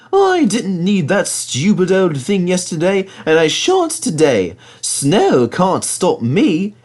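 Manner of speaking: high, slow proud engine voice; boastful little engine. Acted. Thomas boasting.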